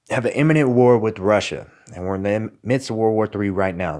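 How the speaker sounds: ordinary talk, nothing marked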